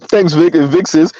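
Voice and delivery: ordinary talk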